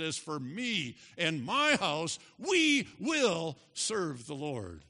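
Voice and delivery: slow, weighty scripture declaration; emphatic declaration. Acted. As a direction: draw out each key word with force. Declaring Joshua's resolve from scripture.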